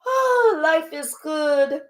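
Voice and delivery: high, slow, dreamy mock joy; imitating someone blissed out and carefree. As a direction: swoon over birds and butterflies in a sing-song. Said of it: "not being like".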